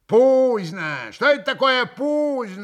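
loud, high, irritated query; раздражённый переспрос. A narrator's reading. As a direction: throw the strange word back crossly. Grushenka irritably queries the Polish word. (громко, с раздражением)

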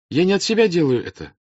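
high, loud appeal to a crowd; earnest princess. Acted. Princess offers bread and homes to peasants, earnest appeal.

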